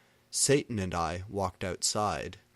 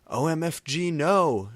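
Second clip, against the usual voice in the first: high loud panicked cry; horrified shout; 8 semitones higher, 8 dB louder than usual. A narrator's reading. Lucian shouting at the drawn knife, loud horrified cry. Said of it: "shouted Lucian".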